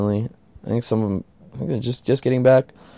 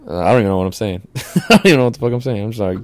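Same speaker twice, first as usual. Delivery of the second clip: usual speaking voice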